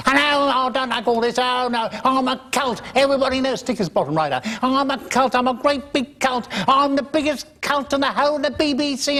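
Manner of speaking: very high camp squeal; shrill mock diva boasting backstage; imitating Kenneth. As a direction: preen and flounce like Kenneth showing off. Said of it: "Kenneth wouldn't go".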